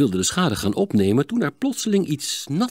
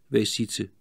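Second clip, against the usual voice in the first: usual speaking voice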